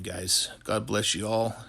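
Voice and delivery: ordinary talk, nothing marked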